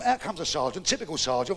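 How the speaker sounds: normal talking voice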